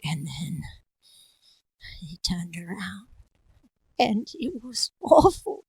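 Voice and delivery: slow, hushed, whispery suspense; spooky storytelling. Acted. Character telling a dreadful tale, hushed suspense. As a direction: draw it out with long pauses and breathy dread. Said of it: "other ones was like".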